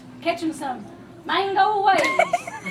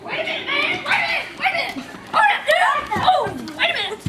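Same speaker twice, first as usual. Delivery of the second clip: high-pitched latecomer's plea; urgent calling out; 7 semitones higher, faster than usual. Acted. Late surfer calling hikers to wait, urgent and high. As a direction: call after a group that is leaving. Latecomer calls to departing group.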